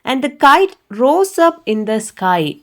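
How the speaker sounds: very slow storybook line, word by word; slow story reading. Shown as a demonstration. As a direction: read it out slowly for the class.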